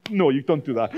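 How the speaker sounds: ordinary talk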